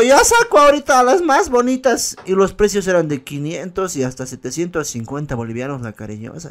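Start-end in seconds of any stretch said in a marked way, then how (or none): 0.0-2.2 high mock madam offering her girls; madam's sales pitch. The brothel madam pitching to the boy, mock high voice. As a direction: hawk the prettiest ones like a madam. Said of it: "Me dijo".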